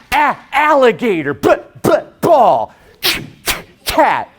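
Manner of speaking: letter sounds popped out one by one in "a-, alligator, but, but, ball"; mock phonics drill. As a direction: repeat each short first sound before "alligator", "ball" and "cat". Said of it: "the way you teach a kid how to read".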